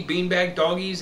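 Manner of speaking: usual speaking voice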